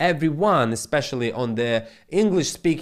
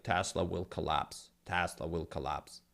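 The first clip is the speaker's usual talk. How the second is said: softer, lower mock news doom; a hint of a doom-saying newsreader; 8 semitones lower, 11 dB quieter than usual. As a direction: parody the gloomy headlines. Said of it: "they've been telling, like".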